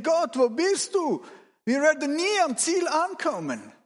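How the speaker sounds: high-pitched despairing plea; desperate cry. Acted. Exhausted rower cries to God in the storm, desperate. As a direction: call out as if abandoned.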